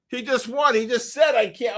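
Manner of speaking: high-pitched excited disbelief; real incredulous excitement; amazed. Real, not acted. Amazed at the same winner.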